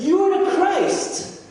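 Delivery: high, loud, drawn-out proclamation; bold declaration. Retold or imitated. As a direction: speak up as an eager disciple. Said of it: "Peter speaks up".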